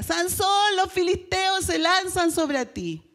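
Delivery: grito agudo y fuerte de alarma; grítale el aviso a Sansón; grito de Dalila. Acted. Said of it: "Dalila grita". (Dalila avisa a Sansón: grito fuerte y agudo)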